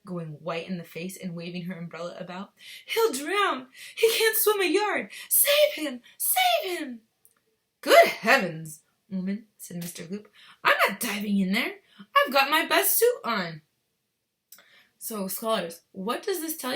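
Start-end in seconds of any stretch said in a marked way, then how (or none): 2.9-7.0 high, loud frantic pleading; panicked scream. Acted. Mother screaming for someone to save her son. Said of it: "screamed Missus Gloop". 7.8-13.6 louder, huffy refusal; indignant father. Acted. Father refusing his wife, indignant.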